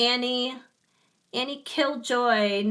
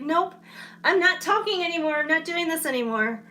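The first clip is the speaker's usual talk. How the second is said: voice refusing to go on; 7 semitones higher than usual. Retold or imitated. Streamer acts out her voice quitting on her, high stubborn protest. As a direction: play her voice as a fed-up little rebel. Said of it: "It was like".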